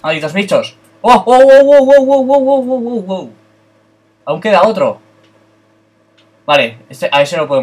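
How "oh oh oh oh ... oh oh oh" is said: panicked yelps. Real, not acted. Zombies swarming, panicked cries.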